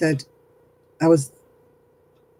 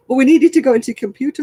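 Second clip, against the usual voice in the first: pushy scam caller; 9 semitones higher, 5 dB louder, much faster than usual. Retold or imitated.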